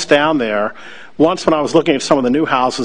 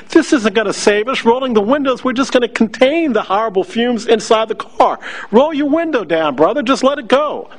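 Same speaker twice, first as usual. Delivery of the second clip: exasperated pleading; 9 semitones higher than usual. Retold or imitated. Urging Gunter to roll the windows down, high and insistent.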